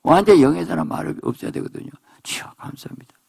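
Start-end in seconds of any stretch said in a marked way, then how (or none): 2.2-3.2 breathy, hushed thanks; whispered prayer. Acted. Spiritual believer whispering a simple thanks to God.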